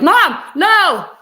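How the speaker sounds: high, loud mock kids' protest; kids protesting. Mom imitating her embarrassed kids in public, loud protest. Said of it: "they're like".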